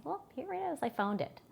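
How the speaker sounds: high, soft pretend surprise; feigned discovery. Caregiver hands Dorothy the backup wallet, feigned light surprise. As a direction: act as if you just happened upon it.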